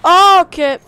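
sudden loud startled "oh". Real, not acted. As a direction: caught off guard, loud. Suddenly sniped at in game.

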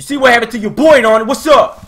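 high, loud, quick challenge; shouted taunt. Real, not acted. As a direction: crow at the enemy loudly. Taunting enemies in game.

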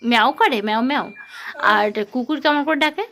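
put-on bright, loud sing-song quizzing of a toddler; loud child-directed teaching. Mother quizzing her toddler on letters, in a loud sing-song. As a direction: coax the little one through letters and numbers, loud and eager.

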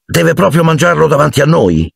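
high-pitched annoyed question; Ron frowning. A narrator's reading. Ron objecting to the cat eating a spider, annoyed. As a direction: ask it in displeased complaint. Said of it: "chiese Ron accigliato".

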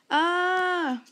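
long, high, loud scream; acted scream. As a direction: scream out on one drawn-out high note. Robert screams seeing ghosts on pier.

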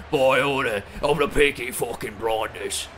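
bad Peaky Blinders British accent; terrible British accent. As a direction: attempt a rough British accent, badly. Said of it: "Terrible, I know".

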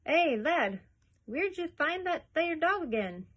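loud, high Yorkshire man calling; Yorkshire workman calling to the boy; broad Yorkshire. A narrator's reading. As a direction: call across the street in broad Yorkshire. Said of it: "in the broadest Yorkshire accent".

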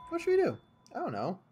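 normal talking voice

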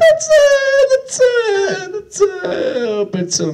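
whining, wavering mock Larry David; host being mimicked in a high whiny Larry David voice; Larry David impression. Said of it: "kinda like a Larry David".

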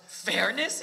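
high, loud incredulous scoff. A narrator's reading. Dairyman answers an appeal to fairness, scoffing. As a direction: throw the word back in disbelief. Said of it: "the dairyman scoffed".

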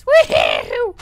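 loud "woohoo" whoop; excited whoop. Pretend. Player cheering as the ride starts, excited whoop.

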